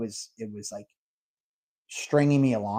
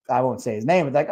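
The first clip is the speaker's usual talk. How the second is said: ordinary talk, nothing marked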